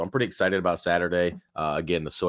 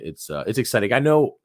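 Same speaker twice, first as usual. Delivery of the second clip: rough, strained voice cracking up; talking through a sore throat; hoarse voice; 6 semitones higher, 6 dB louder than usual. Real, not acted. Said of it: "man, my throat is jacked".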